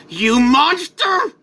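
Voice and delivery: high, loud, slow fury; enraged cry. Acted. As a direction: spit out the accusation in a drawn-out yell. Enraged hero confronts killer.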